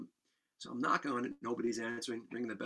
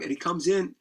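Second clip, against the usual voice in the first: ordinary delivery, nothing marked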